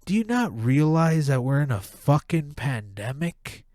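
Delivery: ordinary delivery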